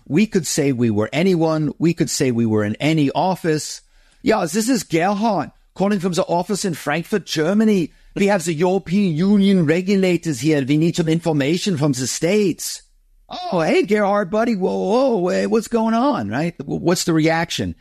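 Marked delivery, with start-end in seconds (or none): 4.2-12.8 put-on a light German accent on an English phone call. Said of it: "You hear that accent". 13.3-16.3 much higher, startled and friendly whoa; surprised greeting. Retold or imitated. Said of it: "People are surprised".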